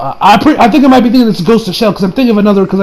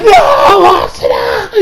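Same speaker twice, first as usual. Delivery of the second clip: anguished cry; 11 semitones higher, much slower than usual. Retold or imitated. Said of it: "so I'm like".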